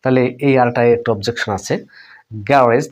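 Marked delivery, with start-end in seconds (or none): 2.5-2.9 "গ্যারেজ" as ga-rez, light r; British pronunciation. Shown as a demonstration. Teacher shows learners British garage, the r softened. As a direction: say "গ্যারেজ" with an e ending and weak r. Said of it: "ব্রিটিশে এভাবে উচ্চারণ হতে পারে".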